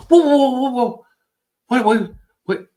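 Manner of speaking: high, loud shocked yelp; alarmed teacher crying out, very high and loud; shocked whoa. Retold or imitated. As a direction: recoil in disbelief out loud.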